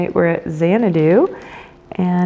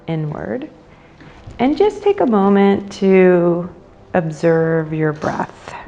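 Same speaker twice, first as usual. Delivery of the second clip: guided meditation. Acted. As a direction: guide them into the breath, unhurried and measured.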